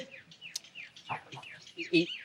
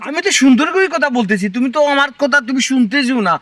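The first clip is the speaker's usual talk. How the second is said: raised, louder self-defence; indignant protest; 5 semitones higher, 5 dB louder than usual. Acted. Husband insisting to wife he speaks nicely, indignant. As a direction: protest with exasperation.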